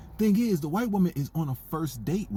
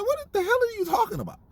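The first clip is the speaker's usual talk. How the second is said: much higher, disbelieving outburst; incredulous. Retold or imitated. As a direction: shriek your disbelief at the screen. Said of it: "I was like".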